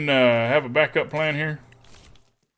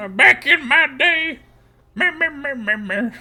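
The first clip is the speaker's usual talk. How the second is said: put-on grumpy old-timer; 9 semitones higher than usual. Mock old-timer grumbling.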